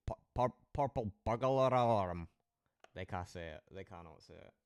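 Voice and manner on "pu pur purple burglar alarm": a stuttering Glaswegian tongue-twister try; stumbling Glaswegian. Retold or imitated. Mocking Glaswegians who can't say it, stumbling Glaswegian accent. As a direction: stumble over "purple burglar alarm" in a Glasgow accent. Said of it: "They go".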